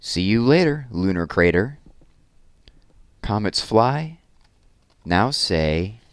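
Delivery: lively, sing-song read-aloud of a rhyming book; reading to children. Acted. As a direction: read it with bounce for the little ones. Reads rhyming rocket picture book.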